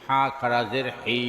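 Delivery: final "হি" held long; drawn-out vowel. Shown as a demonstration. Teacher demonstrating khara jer, long "হি". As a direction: stretch the last "হি" for one alif. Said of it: "একালিপ টেনে পড়তে".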